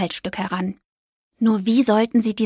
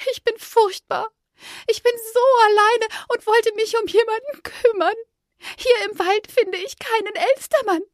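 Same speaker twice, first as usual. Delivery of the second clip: high, tearful magpie voice; sad magpie; 13 semitones higher than usual. A narrator's reading. Lonely magpie admitting her guilt, tearful. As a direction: confess it near tears.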